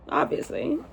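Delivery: breathy giggled word; laughing aside. Real, not acted. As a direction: laugh it off as a joke. Joking she'd be prom queen.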